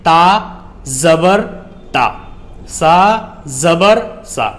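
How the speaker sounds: "تا" "زبر" "تا", each part apart; teacher giving examples of reading with zabar; spelled syllable demo. Shown as a demonstration. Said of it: "جیسے".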